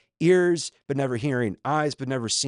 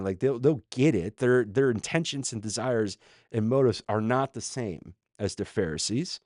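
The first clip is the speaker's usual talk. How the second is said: usual speaking voice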